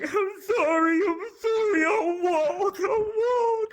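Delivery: very high, far whimpering sorry; mock crying apology. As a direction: whine it like a bloodied drunk. Bleeding drunk friend apologizing.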